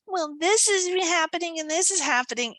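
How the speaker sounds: high-pitched imitation of a complainer; mock complaining. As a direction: mimic her going on about troubles. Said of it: "gushing right now, something not so fun".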